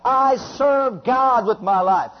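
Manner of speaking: slow, loud and high declaration; fervent declaration. Shown as a demonstration. Preacher declaring devotion to the church, slow and loud. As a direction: proclaim it like a vow.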